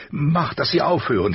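high, desperate cry to make it stop; screaming. A narrator's reading. Caitlin begs them to silence the wolves, screaming. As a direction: scream it out in anguish. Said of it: "schrie sie".

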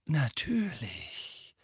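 slow, soft calm reply. A narrator's reading. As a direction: say it serenely.

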